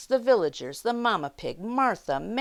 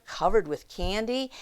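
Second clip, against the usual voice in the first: plain reading voice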